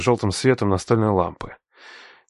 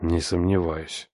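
slow, softer, lower bitter reply; 4 semitones lower, 5 dB quieter, slower than usual. A narrator's reading. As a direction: swallow the bitterness. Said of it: "ответил с горечью".

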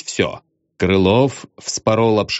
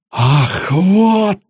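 very slow, high outburst; outraged shout; 11 semitones higher, much slower than usual. A narrator's reading. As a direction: explode in outrage. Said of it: "вскричал полковник".

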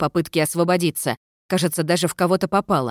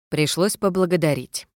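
ordinary delivery

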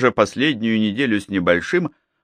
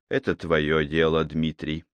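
quiet; 5 semitones lower, 6 dB quieter than usual. Acted. Malcolm's quiet reply.

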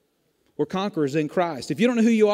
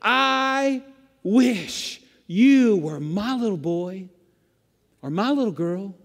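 slow, drawn-out words with long pauses; intense, deliberate delivery, far above a whisper; imitated intimate declaration; much slower than usual. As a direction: stretch each word as God speaking straight to you. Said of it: "God is whispering into your face".

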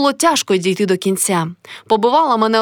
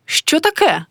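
higher-pitched, coolly curt question; cold; 6 semitones higher, slower than usual. A narrator's reading. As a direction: ask with a touch of coldness. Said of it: "спитала холодно". (холодне запитання)

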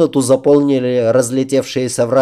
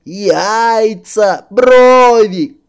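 wailing; 10 semitones higher, 6 dB louder, much slower than usual. A narrator's reading. Princess and king chorus.

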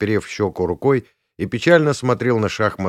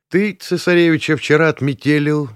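high, pushy thug voice; raised-pitch bully who won't let up; persistent thug; 7 semitones higher, 4 dB louder than usual. A narrator's reading. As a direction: press the accusation, pitched up. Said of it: "не унимался первый бугай".

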